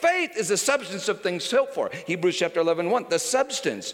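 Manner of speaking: excited pulpit preaching, shouting at the peak; preaching at full force. Acted. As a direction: preach it with rising fire. Continues preaching.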